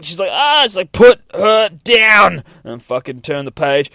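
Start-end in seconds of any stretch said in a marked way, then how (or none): 0.3-0.7 high, loud acted shriek; short mock scream. Girl grabbed by the neck, high mock scream. 0.9-2.4 high, loud, drawn-out order; commanding shout. Acted. Girl to creature holding her friend, commanding shout. Acted demand to release friend.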